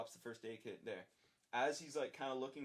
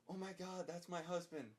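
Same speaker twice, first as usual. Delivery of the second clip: higher, panicked wife's cry; frightened wife; 7 semitones higher than usual. Retold or imitated. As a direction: voice the wife's shock, pitched up. Wife sees her collapsed husband.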